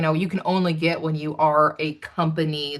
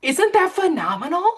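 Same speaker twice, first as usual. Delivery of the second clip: much higher, thrilled question; excited squeal; 12 semitones higher, 4 dB louder than usual. Real, not acted. Host showing the author the cookie picture, thrilled. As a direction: share the thrill, pitched way up.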